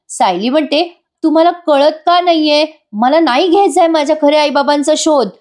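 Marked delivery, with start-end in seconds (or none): 1.2-5.3 higher, insistent mock anger; lightly acted furious refusal; imitating angry wife. Wife refusing husband's search for her parents, acted anger. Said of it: "Sayali आता खूप चिडलेली असते".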